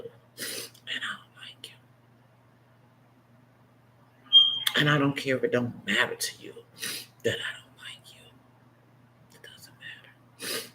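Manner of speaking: near tears, barely voiced whisper; tearful whisper. Real, not acted. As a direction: choke it out in a broken whisper. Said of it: "'cause I get real, real, real emotional".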